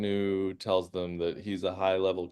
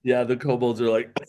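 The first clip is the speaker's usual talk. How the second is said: ordinary delivery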